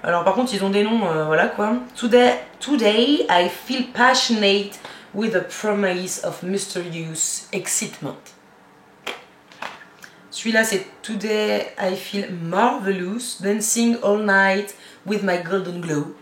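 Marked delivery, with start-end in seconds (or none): 2.0-8.2 slow, dreamy put-on English accent, like a perfume ad. Reading gift shower gel names to viewers, in a hammed-up English accent. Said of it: "mon accent anglais". 11.0-16.2 slow, dreamy put-on English accent, like a perfume ad. Reading gift shower gel names to viewers, in a hammed-up English accent. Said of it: "mon accent anglais".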